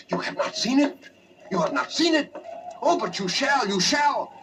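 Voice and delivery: high, wild-eyed madman voice, teasing the question; a frantic host pressing his guest with a mad secret; eerie Roderick. Acted. Roderick bursting in on his friend at night, high and unhinged.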